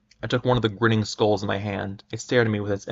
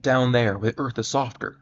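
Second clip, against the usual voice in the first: ordinary delivery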